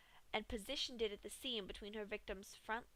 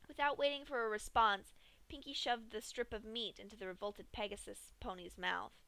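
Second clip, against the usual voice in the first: plain reading voice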